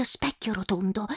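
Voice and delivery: specchio rotondo whispered softly. Acted. Girl whispers to the magic mirror, hushed whisper. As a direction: breathe specchio like a secret spell.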